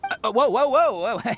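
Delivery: quick high startled whoas; hurriedly halting a rash move; alarmed whoa. Acted. Ito stopping Paimon from a rash choice, alarmed.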